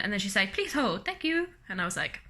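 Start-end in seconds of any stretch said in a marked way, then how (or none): 0.5-1.5 high, singsong operator voice; imitated phone operator. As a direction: chirp it like a switchboard clerk.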